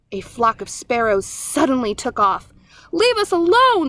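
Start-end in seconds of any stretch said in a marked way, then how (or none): 2.9-3.9 high, frightened squeak. A narrator's reading. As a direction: squeak it out, scared. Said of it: "squeaked Dart".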